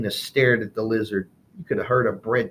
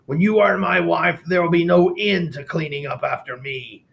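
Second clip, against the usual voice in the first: pig voice; 7 semitones higher, 4 dB louder than usual. Acted. Pompous pig proposes.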